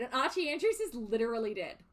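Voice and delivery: usual speaking voice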